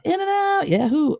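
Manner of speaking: high, joyful whoop; excited cheer. Real, not acted. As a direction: cheer like you just won. Cheering for a favourite burger place.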